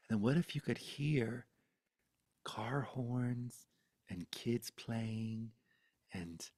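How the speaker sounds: hushed voice of wonder, long pauses; hypnotizing. Retold or imitated. Grandmother coaxing child to imagine, hushed. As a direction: softly spin out each image. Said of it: "she started hypnotizing me with it".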